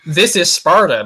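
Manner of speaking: mock Spartan battle cry, slow; a hammed-up movie-trailer shout. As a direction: belt the famous line like the film, drawn out. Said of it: "You know".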